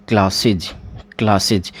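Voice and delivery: क्लासेज as klas-iz; teacher modelling क्लासेज; pronunciation demo. Shown as a demonstration. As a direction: sound out क्लासेज with iz ending. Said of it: "वो आपका क्लासेज हो जाएगा".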